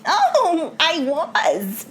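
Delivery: high, laughing mock-smug reply; hammed-up self-satisfaction; preening contestant. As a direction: answer in a pleased little put-on voice. Co-host answers as the contestant.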